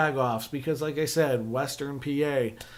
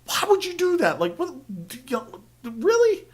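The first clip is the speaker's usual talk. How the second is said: pitched way up in disbelief; incredulous; 9 semitones higher than usual. Retold or imitated. Baffled at a flannel in a fancy hotel, high incredulous. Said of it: "I was just like".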